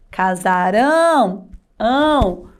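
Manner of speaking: "Casarão" slowly, with a long nasal ão; nasal sound. Shown as a demonstration. Teacher modelling for pupils to repeat, nasal ão. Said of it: "Um som anasalado".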